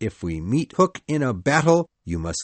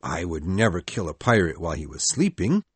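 mild anger; 13 semitones lower, faster than usual. Acted. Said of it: "said Peter angrily".

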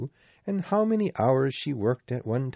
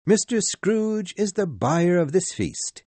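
higher, bright toast voice, MISTER leading; cheerful Bob; 9 semitones higher, 4 dB louder than usual. A narrator's reading. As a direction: announce the host with goodwill. Bob toasts his employer.

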